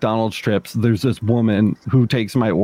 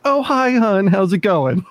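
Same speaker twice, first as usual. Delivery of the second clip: sugary cashier greeting; 8 semitones higher, slower than usual. Retold or imitated. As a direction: gush like a fake-friendly cashier. Mimicking the cashier's sweet greeting to Miranda.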